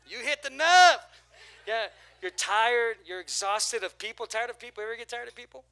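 high, loud preacher's cry; preaching at full force. Acted. Pastor to congregation, loud and fervent. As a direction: lift the room with it.

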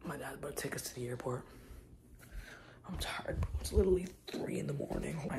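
hushed early morning whisper. Real, not acted. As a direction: whisper so nobody wakes. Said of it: "Just woke up".

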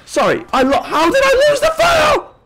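very high, loud indignant yell; outraged shout. Real, not acted. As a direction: shriek it at the game in outrage. Outrage at losing file.

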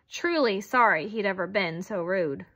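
ordinary delivery

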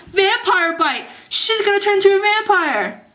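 hammed-up horror; mock alarm. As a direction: parody a panicked TV revelation, pitch climbing. Parodies another TV show's alarm.

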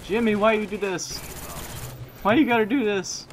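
high, loud mock-betrayed whine; whiny loud complaint. Real, not acted. Complaining to teammate Jimmy, a high loud whine. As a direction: wail at him like he betrayed you.

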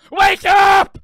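sudden high, very loud shout; playful full-volume holler; mock wake-up yell. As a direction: blast it out to jolt a sleeper awake. Imagined yelling at the jar.

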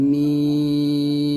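মিম held long, chanted; drawn-out mim. Shown as a demonstration. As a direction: stretch মিম in recitation style. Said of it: "এটাও আমাদের হয়". (টেনে সুর করে মিম)

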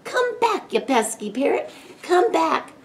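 high-pitched exasperated call; calling after. A narrator's reading. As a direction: call a runaway pet back. Boy calls after escaping parrot.

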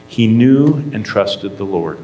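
normal talking voice

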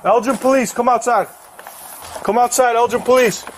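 calling out. Real, not acted. Officers calling out at the door.

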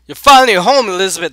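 high, loud cry falling off; theatrical shout. Pretend. Player hailing Elizabeth's return home, hammed-up shout. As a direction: cry out a mock-dramatic welcome. Acting out a dramatic line.

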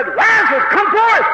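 high, ringing summons; commanding call. Acted. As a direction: call a dead man out of the grave. Jesus calling the dead man out.

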